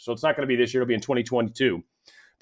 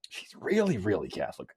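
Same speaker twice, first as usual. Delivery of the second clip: hushed repeat; 4 semitones lower, 6 dB quieter than usual. Acted.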